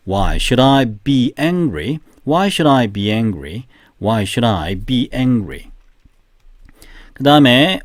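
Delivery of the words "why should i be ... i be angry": very slow, even, word by word; slow repetition drill. Shown as a demonstration. Teacher leading the class drill, very slowly. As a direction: say it slowly three times for the class. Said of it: "세 번 시작".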